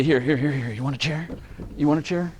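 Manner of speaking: ordinary delivery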